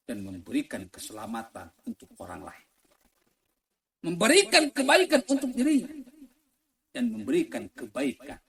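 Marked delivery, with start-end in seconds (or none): 4.1-5.9 high, raised sermon voice; loud preaching. Real, not acted. Preacher lists virtues of the obedient, loud and high. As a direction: call it out high to the crowd.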